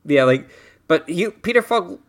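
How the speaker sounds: ordinary talk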